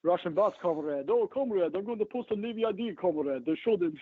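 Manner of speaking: lightly hammed-up Russian accent, comrade; a hint of a Russian bot voice; mock Russian. Mocking online accusers as Russian bots, mild mock Russian accent. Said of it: "I'm not some Russian bots".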